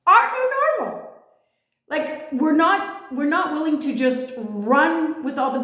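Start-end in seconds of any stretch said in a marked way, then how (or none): 0.1-1.1 emphatic echo. Real, not acted. Echoed slogan, much higher.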